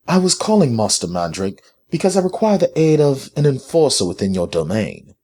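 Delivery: high-pitched, direct formal request. A narrator's reading. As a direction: state the request directly and firmly. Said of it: "she asked directly".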